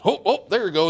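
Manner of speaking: high, loud playful whoops; playful dad. Retold or imitated. Dad dodging a question on the swing, loud playful whoop. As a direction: whoop it to distract the child. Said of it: "the dad just goes".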